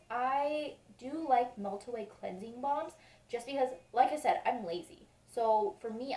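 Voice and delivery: usual speaking voice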